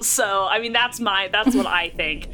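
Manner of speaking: normal talking voice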